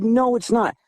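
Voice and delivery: high-pitched contradiction; retold arguing. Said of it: "And we're arguing".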